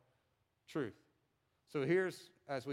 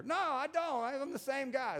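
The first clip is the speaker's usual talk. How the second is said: much higher, louder mock protest from the buddy; defensive friend; 11 semitones higher, 4 dB louder than usual. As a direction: play the friend who swears he hasn't changed. Friend denies acting different.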